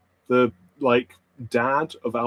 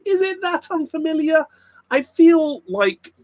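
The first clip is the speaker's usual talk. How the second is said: mock offended; 14 semitones higher, 4 dB louder than usual. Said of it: "mock indignant about accent".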